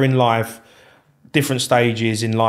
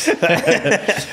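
self-deprecating laugh; 9 semitones higher than usual. Real, not acted. Laughing at himself.

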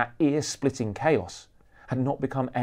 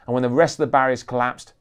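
ordinary delivery

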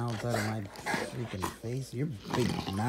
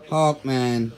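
loud scolding call; 4 semitones higher, 8 dB louder than usual. Real, not acted. Scolding the dog.

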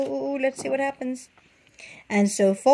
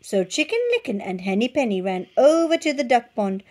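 usual speaking voice

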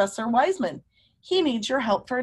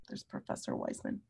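hushed whisper pointing out a picture; 15 dB quieter than usual. Real, not acted. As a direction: breathe it softly, pointing at the page. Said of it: "points at picture, whispered".

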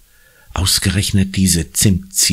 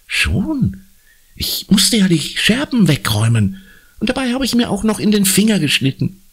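put-on high girlish voice, put out; schoolgirl Conny admitting trouble. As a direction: confess reluctantly in a high child's voice. (hohe, kleinlaute Mädchenstimme)

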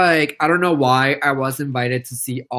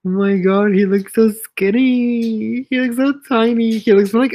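high, amazed cooing over a pet; gushing; 7 semitones higher, 4 dB louder than usual. Real, not acted. Showing viewers the tiny pet, gushing.

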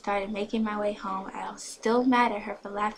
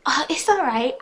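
flustered reassurance; 6 semitones higher, 10 dB louder than usual. A narrator's reading. Flustered reply to boy.

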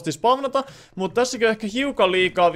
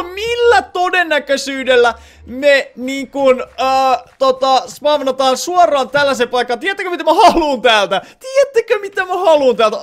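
higher and louder, talking through laughter; giddy, can hardly believe it; laughing excitedly; 5 semitones higher, 4 dB louder than usual. Real, not acted. Excited over lucky find. (naurahteleva innostus)

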